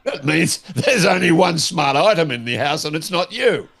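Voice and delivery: ordinary delivery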